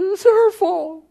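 very high, loud mock accusation; mock blaming. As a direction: squeal the blame in a hammed-up voice. Mimics Adam blaming the woman.

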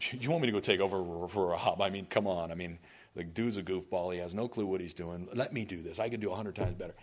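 low, soft grumble; grumbling objection. Acted. Angel objecting to God about a goofball, grumbling.

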